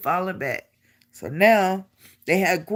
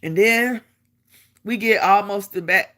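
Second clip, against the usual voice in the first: ordinary delivery, nothing marked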